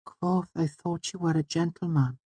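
put-on soft, very high girlish voice; self-conscious high-pitched girl; shy Auri. A narrator's reading. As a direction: reproach shyly, high and quiet. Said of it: "suddenly shy".

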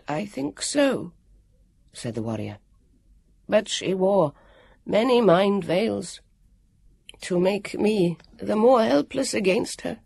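high, slow, gentle; sad warrior. Acted. The warrior telling Elio the egg is fake, high and slow. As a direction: break the news kindly.

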